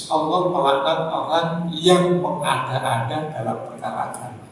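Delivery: ordinary talk, nothing marked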